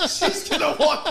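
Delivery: high, loud, laughing mock panic; mock panicked kids. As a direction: play the kids panicking, hamming it up.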